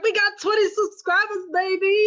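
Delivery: high, loud excited texting voice. Retold or imitated. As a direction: hype it up. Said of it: "I was like".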